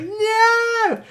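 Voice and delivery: loud falsetto cartoon cry; Charles Martinet as Mario. Nightmare Mario actor refusing to tell, squealed cartoon refusal. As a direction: squeal it out, very high. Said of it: "He's like".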